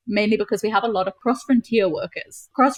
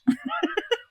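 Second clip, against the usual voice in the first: light laugh with no words; amused laugh; 12 semitones higher, 5 dB quieter than usual. Real, not acted. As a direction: laugh at your own example. Laughs after explaining the word.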